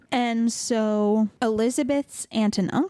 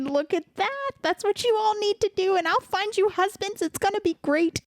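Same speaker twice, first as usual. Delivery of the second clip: high, sing-song bratty boast; bragging Lydia; 13 semitones higher than usual. Retold or imitated. Younger sister bragging to her family, high giddy boast. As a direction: show off like a giddy little sister.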